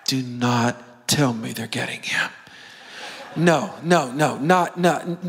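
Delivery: low, deliberate mock dread; disbelieving brother. Brothers hearing David is being fetched, appalled disbelief. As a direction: sink the pitch and refuse to believe it. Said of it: "You know all the brothers are going".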